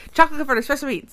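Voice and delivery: rattled off quickly, pitch up; really fast. Real, not acted. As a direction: rush the words out in one burst. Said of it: "you always have to say that really fast".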